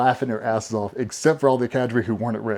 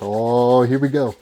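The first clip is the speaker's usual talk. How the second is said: drawn-out, loud rising "oh"; mock anticipation; 7 dB louder, slower than usual. Waiting each Friday for draft news, mock anticipation. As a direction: play up here-it-comes anticipation.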